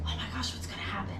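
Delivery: whispered in alarm; spooky ghost tour hush. Retold or imitated. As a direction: breathe it out, scared whisper. Attic crowd gasps at name.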